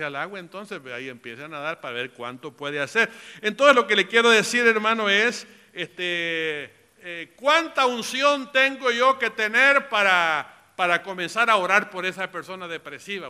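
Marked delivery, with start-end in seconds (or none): 3.4-13.3 raised, loud sermon delivery; forceful preaching. Real, not acted. Preacher telling the church to measure their anointing, forceful preaching. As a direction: drive the point home to the congregation. Preacher presses the point home.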